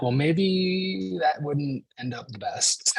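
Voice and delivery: high, drawn-out hesitant suggestion; comically meek, slow pushback; timid objection. Acted. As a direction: stretch "maybe" up high, treading carefully. Timidly restraining his furious wife.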